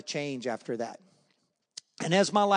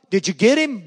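loud, higher mock demand; retold dad's demand; 4 semitones higher, 10 dB louder than usual. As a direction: bellow it like the retold father. Dad questions returning son.

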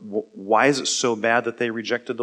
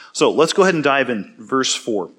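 ordinary talk

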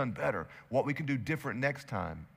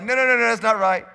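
Jesus correcting; 9 semitones higher, 12 dB louder than usual. Retold or imitated. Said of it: "Jesus is like".

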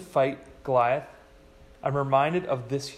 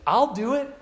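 put-on quick, high, louder vow from the kid; eager kid; 7 semitones higher, 6 dB louder than usual. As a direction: blurt it out like an eager boy. Kid volunteers, quoted.

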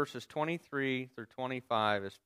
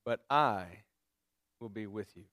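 solemn quote; 5 semitones lower than usual. Retold or imitated. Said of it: "It's a great response".

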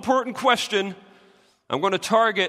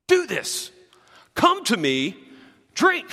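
commanding, with drink pitched up and pushed out; firm command; 4 semitones higher, slower than usual. Shown as a demonstration. Pastor showing the congregation the original Greek imperative, firmly commanding. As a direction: give an order, not an invitation. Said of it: "it's crystal clear in the original".

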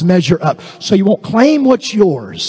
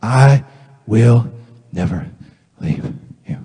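God's reassurance; 8 semitones lower than usual. Acted. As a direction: spell out the promise with pauses, sinking away. God's reply to worst confession.

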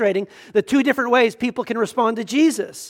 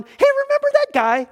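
much higher, airy mock voice of Jesus chatting to the Father. Preacher mocks Jesus asking God the Father, much higher, offhand. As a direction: play it as a light, offhand joke, voice pitched way up. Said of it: "like Jesus is going".

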